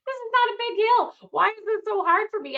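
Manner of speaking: loud, high mock outrage at a small hill; exasperated. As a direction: replay your breathless annoyance at yourself. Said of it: "I'm like".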